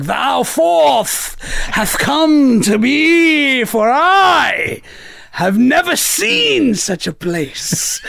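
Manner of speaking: high, slow fake Shakespeare; hammy stage actor. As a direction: ham up made-up Shakespearean verse. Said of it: "you could just be like".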